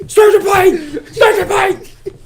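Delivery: very high, strained mock yell; Indiana Jones. Hero running for the plane, high panicked mock shout. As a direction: cry out in hammed-up panic. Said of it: "you see him running, he's like".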